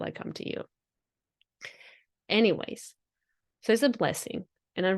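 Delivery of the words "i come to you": soft unvoiced whisper; hushed, mysterious whisper; whispered promise. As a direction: breathe it like a secret from the energy. Energy speaks to the seeker.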